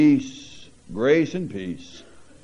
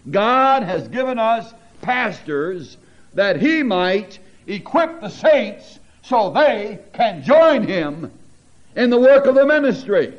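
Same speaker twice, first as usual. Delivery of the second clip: higher, louder pulpit voice, driving the point; full-force preaching; 8 semitones higher, 3 dB louder than usual. Acted. Pastor preaches to the congregation about the carnal comma, fervently.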